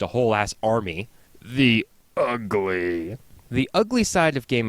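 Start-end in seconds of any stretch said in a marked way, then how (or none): 1.4-3.2 slow dramatic. Acted. Slow dramatic segment header.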